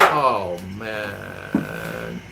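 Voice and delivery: long, low groaned "oh, man"; weary dread. Retold or imitated. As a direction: sink into it, drawn out and soft. Weary reaction to another complaining customer.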